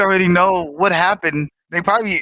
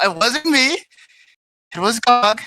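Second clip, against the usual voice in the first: ordinary talk, nothing marked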